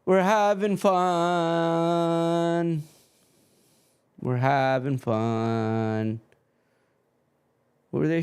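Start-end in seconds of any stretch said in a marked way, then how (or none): 0.0-2.8 high, slow sing-song "We're having fun", each word drawn out; mock-cheerful sarcasm, stretch out "We're having fun"; sarcastic. Stream keeps freezing, sarcastic. 4.2-6.2 slow, flat "We're having fun" in the usual pitch; dry and unimpressed, "We're having fun" said slowly; deadpan. Acted. Stream keeps freezing, deadpan repeat.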